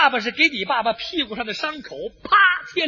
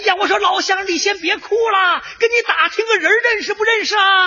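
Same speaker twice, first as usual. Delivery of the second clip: calling out; 6 semitones higher than usual. Acted. Soldier questioning the enemy across the lines, calling out.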